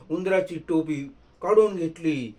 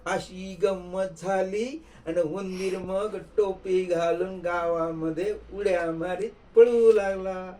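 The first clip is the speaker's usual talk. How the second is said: usual speaking voice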